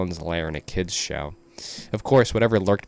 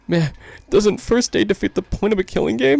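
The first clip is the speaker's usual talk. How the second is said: high character voice; 13 semitones higher, 6 dB louder than usual. Acted. Voicing a character.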